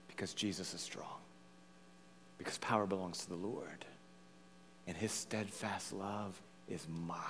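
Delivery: very soft, low, near whisper; hushed assurance. Real, not acted. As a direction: drop right down and confide it reverently. Hushed answer after loud climax.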